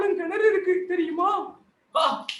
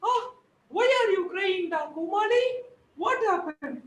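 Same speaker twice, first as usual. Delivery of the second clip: higher, lightly voiced granny; concerned granny; 6 semitones higher, much slower than usual. Granny asks the crying Joker, in a higher voice, what happened. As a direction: ask gently why he cries.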